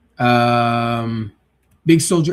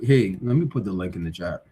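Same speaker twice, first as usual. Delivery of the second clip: usual speaking voice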